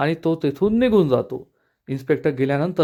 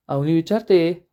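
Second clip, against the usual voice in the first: ordinary delivery, nothing marked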